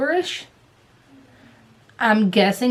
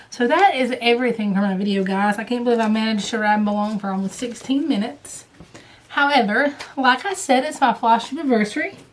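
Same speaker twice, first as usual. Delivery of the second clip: usual speaking voice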